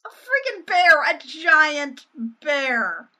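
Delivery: loud, high outburst of disbelief; loud mock astonishment; incredulous exclamation. Real, not acted. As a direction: blurt it out, then stretch it slowly. Exclaims over the absurd bear.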